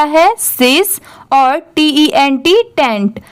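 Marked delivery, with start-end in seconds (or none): none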